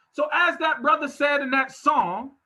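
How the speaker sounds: ordinary talk, nothing marked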